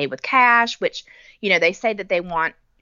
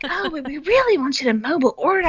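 high-pitched mock customer-service insistence; a hint of an apologetic staffer, voice raised high; lightly voiced employee; 8 semitones higher than usual. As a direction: imitate an insistent worker, pitched up.

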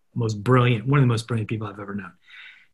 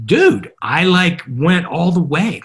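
higher, louder, drawn-out mock excitement; emphatic retelling; 6 semitones higher, 5 dB louder, slower than usual. Retelling his phone call to the old CMO, emphatic and drawn out. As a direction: stretch it out like big news to a buddy.

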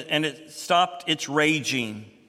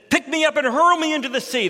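much higher and louder, hitting hurl; forceful command; 10 semitones higher, 5 dB louder, faster than usual. Acted. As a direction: throw the order out boldly. Jonah answers the sailors.

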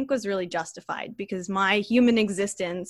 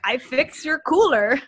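put-on thick Russian accent, pitched up; mock Russian repairman; a playful Russian accent; 6 semitones higher, 5 dB louder than usual. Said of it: "I'm bad at doing a Russian accent".

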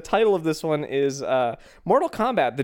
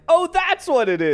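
sudden realization; 11 semitones higher, 4 dB louder than usual. Retold or imitated. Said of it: "and you're like".

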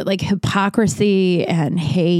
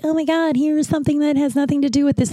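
mocking quote; 8 semitones higher, faster than usual. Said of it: "people were just like".

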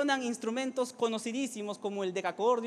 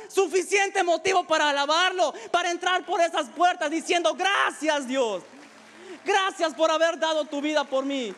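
raised, driving sermon voice; impassioned preaching; 8 semitones higher, 6 dB louder than usual. Acted. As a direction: preach it out to the congregation with rising fervor. Preaches at full force.

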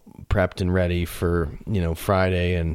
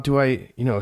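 normal talking voice

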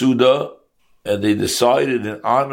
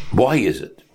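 normal talking voice

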